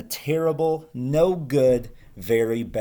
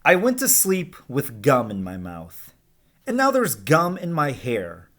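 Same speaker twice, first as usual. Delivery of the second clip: lively, higher storybook voice for the class; reading to children; 5 semitones higher than usual. Acted. Teacher reading Alexander to her class, lively storytelling voice. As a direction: read it like a picture book to kids. Teacher reads picture book aloud.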